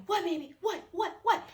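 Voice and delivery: high, soft mock-alarmed questioning; hushed, high-pitched retold alarm; alarmed partner. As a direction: imitate a startled partner firing off questions. Acts out partner reacting to scream.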